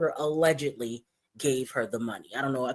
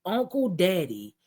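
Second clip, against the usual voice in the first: usual speaking voice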